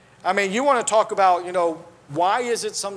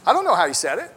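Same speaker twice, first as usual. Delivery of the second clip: usual speaking voice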